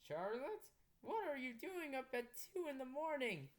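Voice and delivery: puzzled father at the stairs, lightly played; lightly voiced dad. Father finds his daughter up at night, a hint of concern. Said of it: "he asked".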